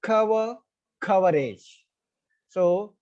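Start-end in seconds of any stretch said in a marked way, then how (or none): 0.0-1.8 "cover, coverage" each stressed on the first syllable. Shown as a demonstration. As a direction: demonstrate "coverage" keeping the opening stress of "cover". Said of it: "the first syllable".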